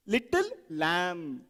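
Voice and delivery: slow, with "lamb" set apart and drawn out; stress on LAMB. Shown as a demonstration. Teacher emphasizing the last word, slow stretched "lamb". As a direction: pause before "lamb" and stretch it. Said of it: "You emphasize each word".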